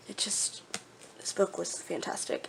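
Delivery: breathy whisper, overcome by the book; hushed awe. Real, not acted. Whispering to viewers about a book that made her cry. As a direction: barely breathe it out, moved.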